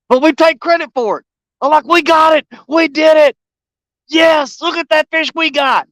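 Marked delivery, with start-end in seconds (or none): 1.8-5.8 high, excited mock cheering; acted-out victory cheer; imitated fan celebration. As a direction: claim the win like a couch fan. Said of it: "screaming and stuff".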